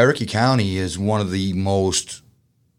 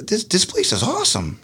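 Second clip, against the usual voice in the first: high, eager retold excitement; excited; 12 semitones higher than usual. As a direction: gush about the new place. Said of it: "I said".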